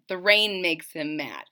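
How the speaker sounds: plain reading voice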